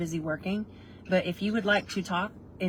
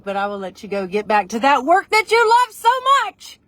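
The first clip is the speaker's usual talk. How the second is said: teasing mock enthusiasm; 7 semitones higher, 11 dB louder than usual. Sending friend back to work, in loud mock enthusiasm. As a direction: build to a loud, high gush.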